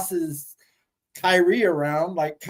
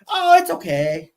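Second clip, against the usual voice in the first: put-on very high, sweet Japanese accent; 13 semitones higher, 5 dB louder than usual. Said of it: "your Japanese accent was pretty funny".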